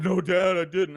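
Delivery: high, loud insistent denial; loud kid denial. Retold or imitated. Boy lying to his father, loud high denial. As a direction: shout the lie at dad. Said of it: "And Sean said".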